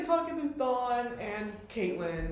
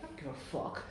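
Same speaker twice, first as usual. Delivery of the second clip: flat dismissive; 7 semitones lower, 10 dB quieter than usual. Retold or imitated. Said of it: "this is what Barry's like".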